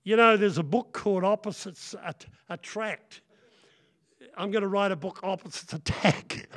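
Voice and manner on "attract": ordinary talk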